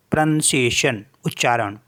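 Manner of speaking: normal talking voice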